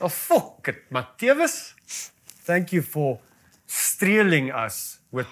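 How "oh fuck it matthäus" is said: lightly done Afrikaans accent swear. Shown as a demonstration. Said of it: "in Afrikaans accent".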